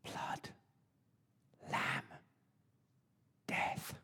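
hushed, secretive whisper. Retold or imitated. Father whispering grim news to mum, soft whisper. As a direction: breathe the three words almost silently, one by one. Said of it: "he whispered to my mum".

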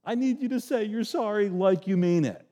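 hammed-up wounded complaint; mock whiny God. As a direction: whine like someone offended. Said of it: "God is not up in heaven going".

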